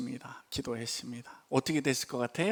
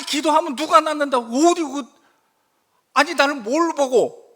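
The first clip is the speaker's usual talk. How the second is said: loud, high exasperated ranting; grumbling; 12 semitones higher, 10 dB louder than usual. Retold or imitated. Pastor grumbling about the boss after the call, loud exasperated rant. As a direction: rant in disbelief to yourself. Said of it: "제가 궁시렁대기 시작".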